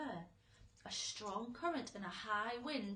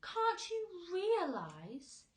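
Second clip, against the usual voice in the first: slow, drawn-out patient question; 10 semitones higher, 9 dB louder, slower than usual. A narrator's reading. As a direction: explain it gently, step by step. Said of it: "he said patiently".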